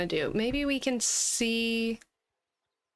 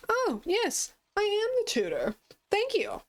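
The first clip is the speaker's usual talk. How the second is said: much higher, sugary fake-innocent voice; fake innocent tutor; 11 semitones higher than usual. Reporter posing as the sister's tutor, high-pitched fake innocence. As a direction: squeak it out like a bad liar.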